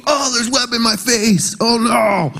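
high, hammed-up fake alarm; mock dismay. Father playing the victim for his Spider-Man son, high mock alarm. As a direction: overplay being hit by webs. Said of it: "demands that you go".

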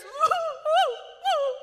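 high, loud drawn-out mock sob; mock wailing. Preacher mimics crying along to a song, mock wail. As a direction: wail theatrically, wallowing in it. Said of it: "song that I know is just gonna hit".

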